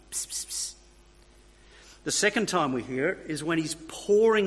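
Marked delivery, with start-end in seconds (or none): 0.1-0.7 whispered psst psst; a soft conspiratorial psst; tempter hissing psst. Pretend. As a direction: hiss a secret whisper. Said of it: "that little voice is saying".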